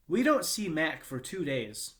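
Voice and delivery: plain reading voice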